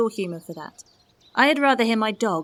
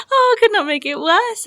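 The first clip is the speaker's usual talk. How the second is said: high, loud laughing taunt; laughing mockery; 11 semitones higher, 8 dB louder than usual. Beatrice laughing at Benedick.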